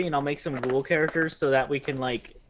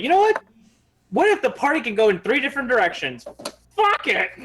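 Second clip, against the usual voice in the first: high, sudden burst of glee; a mock eureka moment; excited; 8 semitones higher, 4 dB louder than usual. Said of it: "for some reason I was like".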